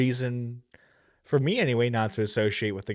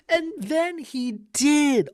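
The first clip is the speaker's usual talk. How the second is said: high, drawn-out sarcastic wonder; mock amazement; 13 semitones higher, slower than usual. Reactor ridiculing a man's accent gag, mock amazement. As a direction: stretch it out high, as if hugely impressed.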